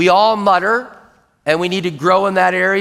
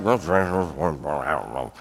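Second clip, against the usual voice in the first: slow, low unintelligible mumble; muttering; 13 semitones lower, 8 dB quieter, much slower than usual. Shown as a demonstration. Person alone grumbling to himself, muttering. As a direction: smear the words into a soft, deep grumble. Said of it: "Muttering, you're by yourself".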